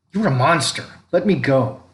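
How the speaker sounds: high, loud desperate shout; desperate cry. A narrator's reading. Boy held by the throat cries out at his father. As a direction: fight him off with your voice.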